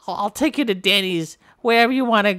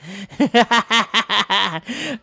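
mock villain laugh. Villain laugh after threat.

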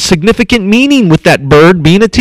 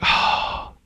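soft sighing, breathed out and slow; an easy relieved exhale; calm relief; 7 semitones lower, 5 dB quieter, much slower than usual. Shown as a demonstration. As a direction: let the tension go with a sigh. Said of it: "you're calm".

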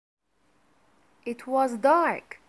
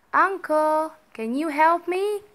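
higher, louder call for help; raised voice, pleading upward; 5 semitones higher, 4 dB louder than usual. A narrator's reading. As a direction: call up to someone far off. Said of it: "he called".

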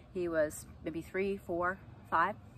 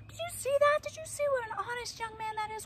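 much higher, gushing praise voice; gushing lady. Retold or imitated. Fair woman telling mom about her honest son, gushing high. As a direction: sing his praises like a delighted stranger.

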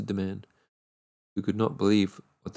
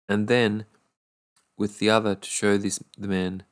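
ordinary delivery

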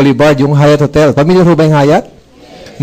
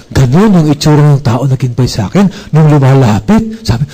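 ordinary delivery

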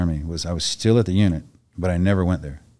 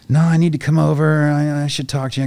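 high, loud mock pushy caller; raised-voice retold insistence; insistent commander; 9 semitones higher, 6 dB louder than usual. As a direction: imitate someone insisting over every objection.

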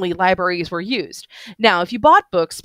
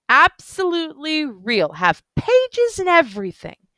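put-on higher, eager tipsy voice of an old bookish drunk; excited drunk man; 9 semitones higher, slower than usual. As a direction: gush about the books like a tipsy fan. Drunk man insists books real.

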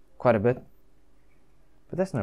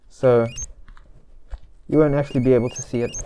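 muffled behind fabric covering; through a face mask; 2 semitones higher, 6 dB louder than usual. Real, not acted. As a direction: voice dampened by cloth mask. Seen 0: Fabric mask covers his mouth and nose throughout the labelled words.

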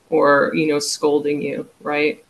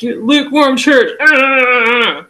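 much higher and louder, a ranting preacher caricature; mock hellfire preacher; 9 semitones higher, 10 dB louder, slower than usual. Mocking sermons on the lukewarm church, loud preacher rant. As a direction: belt it out like a scolding pulpit preacher. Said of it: "that in that tone".

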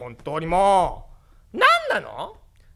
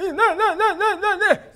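toothless ancient grandpa; 12 semitones higher, 5 dB louder, faster than usual. Acted.

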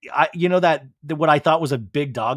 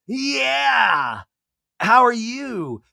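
drawn-out, high mock reply; high-pitched imitation; 10 semitones higher, 4 dB louder, much slower than usual. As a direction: answer in a put-on high voice, stretched out. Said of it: "[name] uses a high-pitched voice".

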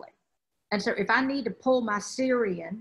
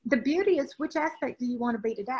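ordinary talk

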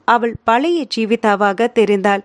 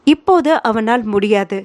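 usual speaking voice

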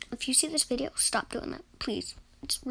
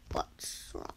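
whisper; 7 dB quieter than usual. Real, not acted. Asks teammate twice, whispered.

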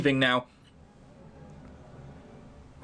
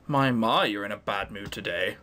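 smarmy sarcastic; 7 semitones lower, 3 dB quieter than usual. Acted. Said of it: "sarcastic, smarmy".

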